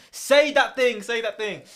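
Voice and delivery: loud, high-pitched urging; frustrated shouting. Retold or imitated. Listener shouting the joke at the podcast, frustrated. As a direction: yell at the podcast, acting out frustration. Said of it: "I'm shouting at my thing going".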